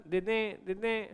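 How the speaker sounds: soft, half-hearted beggar's plea; meek begging. Shown as a demonstration. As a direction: ask quietly, with no pressure behind it. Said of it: "I am acting like British". (दबी आवाज़ में हल्की याचना)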